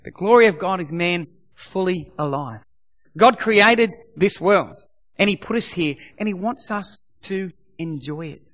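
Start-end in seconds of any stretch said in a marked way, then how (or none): none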